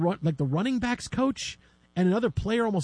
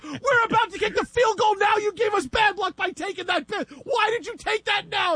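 angry fan. Retold or imitated. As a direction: blame him for the bad luck, shouting. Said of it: "The guy drops and goes".

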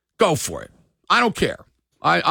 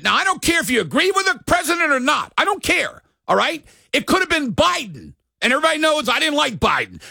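angry rant; 7 semitones higher than usual. Real, not acted. Sports radio host yelling about the golfer. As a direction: yell it out, fed up and furious.

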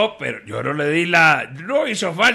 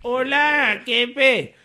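high-pitched sidekick greeting; Jorgito; 7 semitones higher, much slower than usual. Acted. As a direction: answer the boss in a high, drawn-out little voice. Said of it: "le dice al otro". (saludo agudo de ayudante)

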